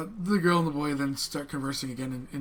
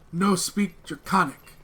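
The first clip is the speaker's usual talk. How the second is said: slow broken Common; 2 semitones higher, 5 dB louder, slower than usual. Acted. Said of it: "The girl looks and says".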